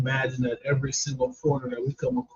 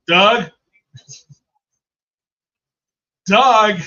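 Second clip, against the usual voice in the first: loud, high name call from the back; bold calling out; 5 semitones higher, 9 dB louder than usual. Retold or imitated. Sandwich worker calling out a customer's order, bold and loud. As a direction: holler the name so everybody can hear. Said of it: "he said it from down in here so that he can make sure everybody can hear him call the name out".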